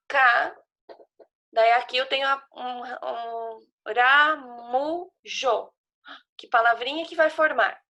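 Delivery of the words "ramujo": RAMUJO stretched as ra, mu, jo; "ramujo" in three long, deliberate beats; syllable by syllable. Shown as a demonstration. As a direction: sound out "ramujo" very slowly in separate syllables. Teacher sounding out syllables to join.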